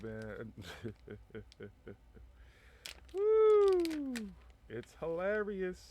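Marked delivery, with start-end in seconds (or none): 3.1-4.1 high loud woo; loud whoop. Real, not acted. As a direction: whoop with delight. Loud whoop after laugh.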